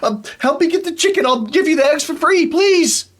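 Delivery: very high, loud desperate cry for help; panicked shrieking farmer. Acted. Farmer begging the party to catch his escaped chicken, shrieking high. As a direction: scream after your runaway chicken. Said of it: "and he goes".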